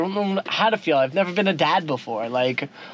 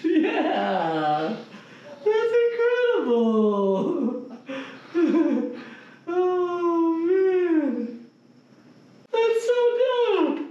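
high, slow, thrilled cheering; excited; 10 semitones higher, much slower than usual. Real, not acted. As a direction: cheer with pure joy. Celebrating the pregnancy news.

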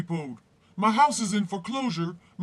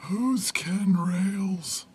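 slow puzzled whisper; much slower than usual. Acted. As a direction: whisper it, confused. Confused whisper.

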